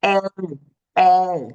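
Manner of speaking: ay, ay drawn and stressed; long A sound demo. Shown as a demonstration. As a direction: hold out ay. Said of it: "that long A sound".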